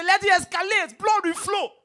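a hammed-up brawler shouting; mock threat. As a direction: roar it like a hothead spoiling for a fight.

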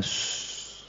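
unvoiced hush to calm; soft shush. The angry self calming down, a soft shush. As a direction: breathe out a quiet shh. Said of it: "you calm down".

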